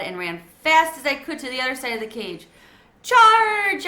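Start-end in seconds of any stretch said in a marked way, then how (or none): 3.0-3.9 high, loud squeaky charge; squeaked battle cry. A narrator's reading. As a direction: shout it like a tiny warrior charging. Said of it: "I squeaked".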